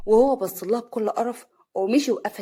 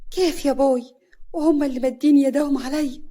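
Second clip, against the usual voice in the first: raised, pleading protest; tearful; 4 semitones higher, 6 dB louder than usual. A narrator's reading. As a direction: plead through tears, mildly wailing. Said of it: "هنا بدموع". (بدموع واحتجاج)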